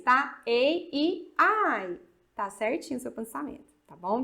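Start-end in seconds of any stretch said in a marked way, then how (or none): 0.4-2.0 "Ei, i, ai" lightly lifted; vowel reminder for the class; English vowel names. Shown as a demonstration. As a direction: repeat the vowel names a bit higher. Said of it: "pensa isso".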